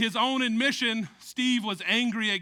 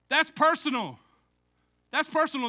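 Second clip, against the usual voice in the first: ordinary talk, nothing marked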